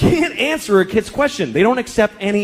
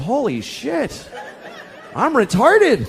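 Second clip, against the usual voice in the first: mock realization; 5 semitones higher, much slower than usual. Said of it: "I looked around and I realized".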